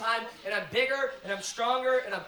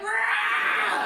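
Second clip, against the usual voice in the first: high, loud, shouted roar; monster roar; 11 semitones higher, 6 dB louder than usual. Retold or imitated. Baby bursting out at birth, loud monster roar. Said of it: "ripping out of my wife, like".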